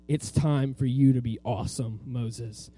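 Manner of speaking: low, measured voice of God; a hint of a lordly God; God speaking. Voicing God to Moses.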